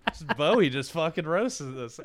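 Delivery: normal talking voice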